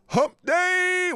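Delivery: high, loud, stretched-out "day"; hyped announcement. Real, not acted. Host telling viewers it is hump day, hyped drawn-out call. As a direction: call it out big and slow, holding the last word.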